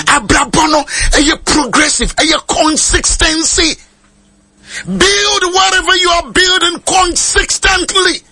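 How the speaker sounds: slow, high preacher's exhortation; slow high preaching. Real, not acted. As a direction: draw each word out, rising.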